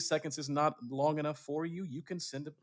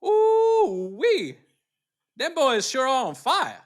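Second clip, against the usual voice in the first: much higher, louder, slow hammed-up Georgia accent; Georgia commenter; 11 semitones higher, 11 dB louder, much slower than usual. Answering what a Georgia comment sounds like, hammed-up Georgia accent. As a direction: holler like a thrilled Georgia fan. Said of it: "what would a comment be like from, from Georgia".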